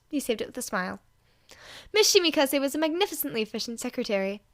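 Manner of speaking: ordinary delivery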